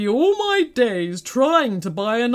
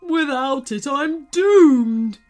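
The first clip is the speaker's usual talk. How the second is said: high wail swelling louder; hammed-up sobbing; 4 semitones higher, 6 dB louder, slower than usual. A narrator's reading. Giant explains the theft, sobbing. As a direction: build to a loud, doomed cry. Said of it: "sobbed the giant".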